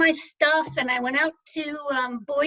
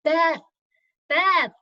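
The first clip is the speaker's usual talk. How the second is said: a light mock call from far off; calling a name across a lake; 3 semitones higher, 4 dB louder than usual. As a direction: call her name over the water. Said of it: "somebody's yelling".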